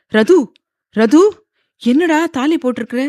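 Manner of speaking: high, halting, disbelieving question; shocked. A narrator's reading. Father seeing daughter's wedding chain, shocked. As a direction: call out twice, then ask in shock. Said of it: "தாலியை பார்த்து அதிர்ந்து".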